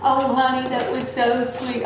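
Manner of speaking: high-pitched, touched gushing, only lightly acted; tearful nurse, imitated. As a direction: imitate a nurse moved to tears. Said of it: "And the nurse started crying".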